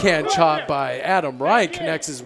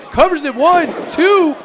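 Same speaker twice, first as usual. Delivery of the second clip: rising, louder pin count; excited count; 9 semitones higher, 5 dB louder than usual. Real, not acted.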